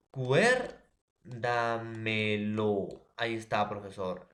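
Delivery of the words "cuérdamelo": "cuérdamelo" very drawn out, with a loud first "cuér"; acento en cuér. Shown as a demonstration. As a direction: stretch "cuérdamelo" syllable by syllable, stressing "cuér". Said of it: "en la tras antepenúltima va a estar". («cuérdamelo» muy alargado, con fuerza en «cuér»)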